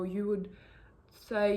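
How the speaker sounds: normal talking voice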